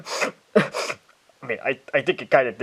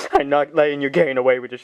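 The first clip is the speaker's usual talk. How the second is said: normal talking voice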